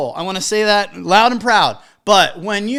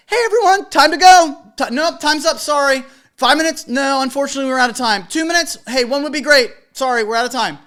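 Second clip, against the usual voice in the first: higher, louder upbeat call; cheerful rounding-up; 5 semitones higher, 6 dB louder than usual. Retold or imitated. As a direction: herd them along brightly but firmly. DJ hustling party along.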